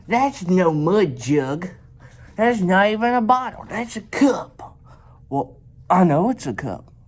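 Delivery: high silly self-mocking voice; mock dumb voice. Mocking himself about the cup.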